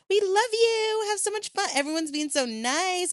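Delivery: very high, bright mock-adoring gush; sarcastic sugary high voice; gushing sweet voice. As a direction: play the country suddenly adoring her, high-pitched. Montenegro personified gushing.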